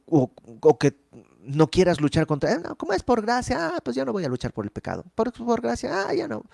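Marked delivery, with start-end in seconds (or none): 2.5-6.4 high, mock-innocent voice of a believer dodging the fight; mocking lazy believer. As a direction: pitch it up like a naive excuse-maker. (voz burlona y aguda de creyente que se excusa)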